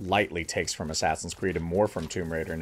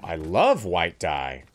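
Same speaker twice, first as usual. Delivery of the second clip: mock-rapturous, drawn-out sarcasm; sarcastic; 4 dB louder, much slower than usual. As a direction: gush slowly with fake delight. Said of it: "I said sarcastically".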